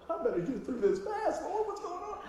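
comic panic with laughter in it; mock fluster. Preacher retells his alarm at her crying, comically flustered. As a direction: let a laugh break into the flustered aside.

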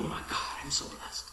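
hushed, overwhelmed gratitude; awed whisper. Retold or imitated. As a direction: breathe it out in wonder. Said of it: "And you go".

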